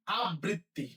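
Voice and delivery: আবৃত্তি run together as ab-britti; common mispronunciation. Shown as a demonstration. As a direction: close the first syllable of আবৃত্তি on b, saying ab-britti. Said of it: "সবাই বলি".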